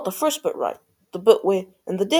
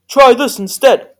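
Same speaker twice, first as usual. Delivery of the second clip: loud, raised, slow-paced comeback; yelled retort; 5 semitones higher, 10 dB louder, slower than usual. A narrator's reading. Prince answering sister number two with his sword, yelling. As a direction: yell it back, drawing out the words. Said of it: "the prince yelled back".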